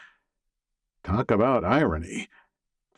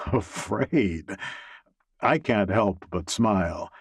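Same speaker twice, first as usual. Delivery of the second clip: ordinary delivery, nothing marked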